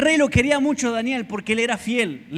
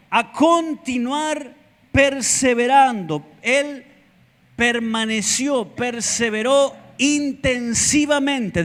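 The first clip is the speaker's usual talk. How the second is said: slow emphatic preaching; 5 semitones higher, slower than usual. Acted. Preacher drawing out the word.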